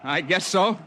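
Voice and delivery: narrator's younger self in the crevasse. Acted. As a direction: shout up the ice wall, cheerful and relieved.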